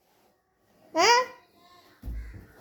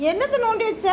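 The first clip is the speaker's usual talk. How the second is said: என்னத்த asked again, pitched higher; higher exasperated repeat; 8 semitones higher than usual. Acted. Mother repeats the scolding question. (எரிச்சலுடன் உயர்ந்த குரலில் மீண்டும் கேள்வி)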